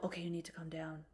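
soft, quick self-talk; telling herself to calm down near a dog; inner calming voice. Retold or imitated. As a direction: hush yourself calm so the dog won't notice. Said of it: "I mentally go".

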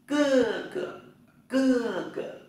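cưa cửa said very high, cưa falling hard; Chinese tone drill. Shown as a demonstration. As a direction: demo cưa cửa with a sharp falling first syllable. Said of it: "Anh trai".

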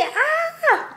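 sudden high squeal of joy; excited squeal. Real, not acted. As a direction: squeal with delight. Setting up new vanity.

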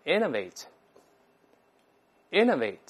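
innovate with the stress on the first syllable, IN-novate, said very slowly. Shown as a demonstration. Teacher shows the class the stress at the front, slowly. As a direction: hit the opening syllable of innovate. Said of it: "注意重音在前面".

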